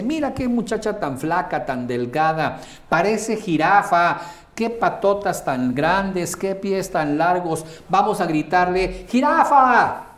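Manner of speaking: high, loud mocking voice building to a yell of jirafa; mocking friend. Friend mocking a tall thin girl, high jeering voice building to a shout. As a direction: jeer like a rude friend on the street. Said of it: "mi amigo dice".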